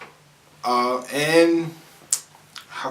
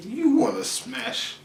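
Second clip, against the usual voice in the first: suggestive voice; 11 semitones higher, 3 dB quieter, slower than usual. Retold or imitated. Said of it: "I was like".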